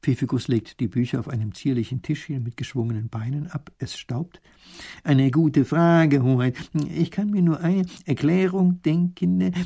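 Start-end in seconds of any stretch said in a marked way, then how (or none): 5.0-9.5 high, slow, hesitant scholar; halting old professor. A narrator's reading. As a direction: ponder aloud with drawn-out words.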